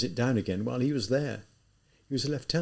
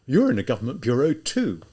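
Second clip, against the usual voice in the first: louder retort hitting You're, at usual pitch; exclaiming; 5 dB louder than usual. Acted. Student throwing the rebuke back at the lieutenant, louder. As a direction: throw the same words back at him. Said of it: "Raskolnikov exclaimed".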